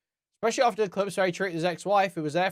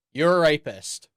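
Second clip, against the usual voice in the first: ordinary talk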